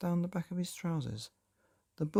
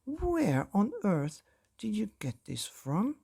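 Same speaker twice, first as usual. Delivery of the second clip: high, flustered outburst; father spluttering at his daughter; 7 semitones higher than usual. A narrator's reading. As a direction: splutter it out in shock. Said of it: "he spluttered".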